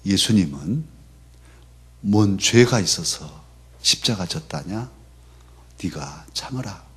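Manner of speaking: gently echoing a mother's Jeolla saying; mother's Jeolla dialect. Retold or imitated. Mother consoling her son in Jeolla dialect, quiet and gentle. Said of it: "전라도 사투리로".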